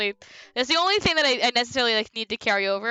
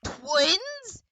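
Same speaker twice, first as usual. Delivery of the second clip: astonished; 3 semitones higher, 4 dB louder, much slower than usual. Acted. Amazed at friend's news in game.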